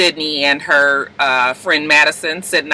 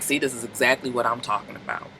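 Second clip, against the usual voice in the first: smug told-you-so; 5 semitones lower, 11 dB quieter than usual. Retold or imitated. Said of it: "The father was just like".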